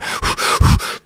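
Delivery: imitated panicky puffs of breath; hard breathing. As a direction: puff hard and quick, as if panicking. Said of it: "Breathing hard".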